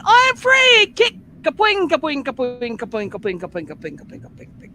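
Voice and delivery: very high, loud frog cry of freedom fading to a breathless whisper of kapoings; gleeful cartoon frog. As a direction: shout free like an escaped frog, then let the kicks die away to nothing. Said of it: "And the frog has gone".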